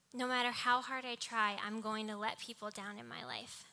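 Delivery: ordinary talk, nothing marked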